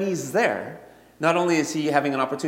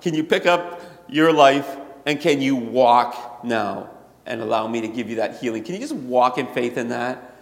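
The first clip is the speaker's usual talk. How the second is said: ordinary delivery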